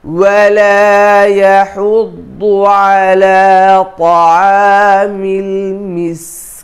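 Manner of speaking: slow, melodic tilawat chanting; Quran recitation. Shown as a demonstration. Teacher recites the whole surah slowly for students.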